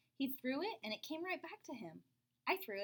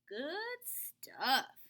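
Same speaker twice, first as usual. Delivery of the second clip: mumbled mouthful; 3 semitones higher, 6 dB louder than usual. Acted. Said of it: "he mumbled through a mouthful".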